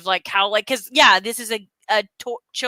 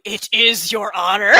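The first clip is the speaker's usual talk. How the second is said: slow, lordly and smug; lightly voiced arrogant villain; haughty Megatron; 3 dB louder than usual. Megatron correcting a fawning soldier, lightly voiced smug. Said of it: "Just the ego on this man".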